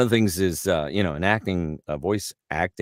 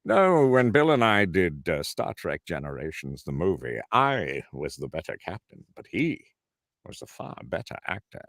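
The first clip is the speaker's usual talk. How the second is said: Patrick Stewart. Shown as a demonstration. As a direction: stretch key words like a stage actor. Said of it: "Well, let me hear your Pat Stewart".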